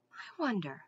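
murmured musing, well under full voice; soft. A narrator's reading. Mendenbar wondering to himself at the clearing, softly. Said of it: "he said softly".

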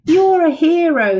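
high loud cheering praise; excited praise. Acted. Children cheer the puppy as a hero, high and loud.

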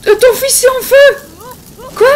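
loud, high, drawn-out alarm; panicked yell. Pretend. Player sees the son on fire, panicked yell.

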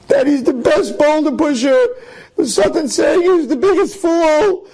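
high, crying despair; the words crack with hurt. Acted. Yankel breaks down over Satan's words, crying despair.

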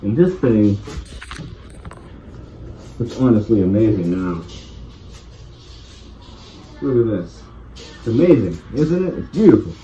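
muffled behind mask; speaking inside sealed respirator; through a gas mask. Real, not acted. Seen 0: Full gas mask with hose covers face throughout the labelled words.

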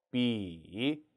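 low dipping 비 that rises at the end; third tone. Shown as a demonstration. Teacher calling 삼성, 비 in a low dipping tone. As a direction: dip 비 low, then bring it up. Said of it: "삼성".

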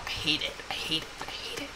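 low, fading murmur; defeated mutter. Real, not acted. After the sled flips, low fading mutter. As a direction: mutter it, trailing off.

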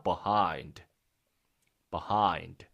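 "behind" low, as buh-HIND, with pauses; dictation word. Acted. Teacher dictating to first graders, low measured "behind". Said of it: "받아쓰기 시작하니다".

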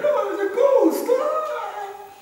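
very high, loud wailing shriek; comic imitation of terrified night screams; mock screaming. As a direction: scream as if a ghost appeared, hammed up. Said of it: "people were screaming at night".

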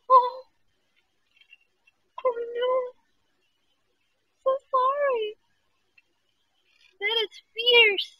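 loud high startled cries; surprised yelps at a hissing kitten. Real, not acted. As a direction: jump at the hiss.

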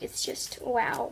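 breathless, whispered wow of awe; awed whisper. Real, not acted. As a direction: let the wow slip out as a whisper. Awed by a squishy.

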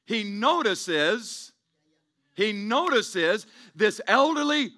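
drawn-out, weighty telling of the story; slow sermon storytelling. Acted. Pastor slowing down to paint the widow scene, weighty and deliberate. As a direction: linger on every word like a parable.

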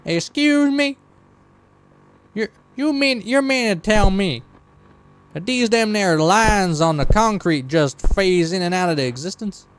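put-on high, incredulous country accent easing down. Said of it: "It's kind of a little bit of country".

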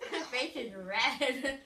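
slow, laughing through sourness. Real, not acted. Laughing while eating sour candy.

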